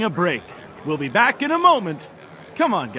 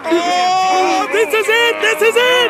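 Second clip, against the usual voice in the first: higher, rising with excitement; excited; 8 semitones higher than usual. Acted. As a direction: call the climax like a hyped host. Announcer at the match's climax.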